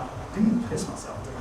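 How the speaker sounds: breathless whisper to yourself; whispered worry. Retold or imitated. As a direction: whisper it in drunk panic. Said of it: "and I'm like".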